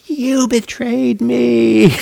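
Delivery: high, slow hammed-up cry of betrayal; mock betrayed yell. Imagined student bursting in, yelling at patient teachers, hammed-up. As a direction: play-act an outraged student yelling. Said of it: "is like".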